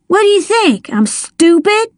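high, incredulous scoff. A narrator's reading. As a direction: throw the bribe back. Dale scoffs.

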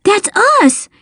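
high-pitched burst of excitement; excited yelp. A narrator's reading. Dale hears his side won the race, high excited yelp. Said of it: "Dale yelped".

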